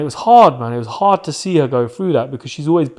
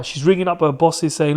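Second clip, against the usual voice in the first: normal talking voice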